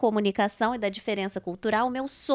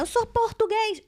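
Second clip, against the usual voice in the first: indignant declaration; 11 semitones higher than usual. Retold or imitated. Father-in-law flashing his ID card.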